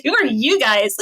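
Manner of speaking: imagined host's question through giggles; mock-confused host, laughing; laughing quote. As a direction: ask it while cracking up. Imagined homeowner, teller laughing.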